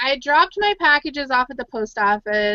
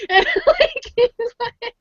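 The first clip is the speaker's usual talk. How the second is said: laughing; 12 semitones higher, slower than usual. Real, not acted. Laughing while retelling crying.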